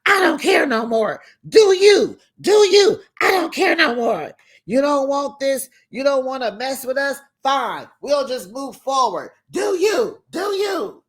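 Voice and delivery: hammed-up furious mother shouting at her family; frustrated mother yelling. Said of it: "so she just frustrated".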